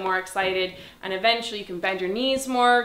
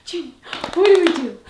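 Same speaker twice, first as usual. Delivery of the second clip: higher and louder dog-talk question; high excited prompt; 9 semitones higher, 10 dB louder than usual. Real, not acted. Trainer prompting Junie to lie down. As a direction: perk her up with a bright call.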